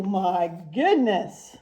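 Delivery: usual speaking voice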